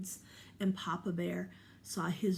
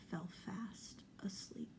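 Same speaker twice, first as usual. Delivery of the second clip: hushed whispered narration; storyteller whisper; 13 dB quieter than usual. A narrator's reading. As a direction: whisper it like a secret at bedtime.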